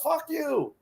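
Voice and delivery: high, drawn-out mock yell; high, held-back retold shout. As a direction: imitate a distant yell without real volume. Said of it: "he yells out".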